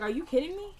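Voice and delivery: retold incredulous, pitch jumping high; acted disbelief. Lawyer protesting to the judge, incredulous. George objects to the ruling in court.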